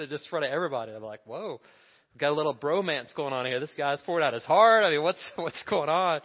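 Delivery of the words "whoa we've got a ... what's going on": startled, laughing, louder mid-way; amused disbelief at a sudden bromance; laughing mock surprise. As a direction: play up your own bewildered chuckle. His own startled reaction retold.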